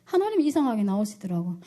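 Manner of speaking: plain reading voice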